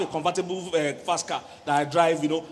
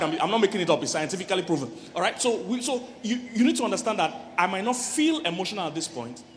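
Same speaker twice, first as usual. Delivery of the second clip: ordinary delivery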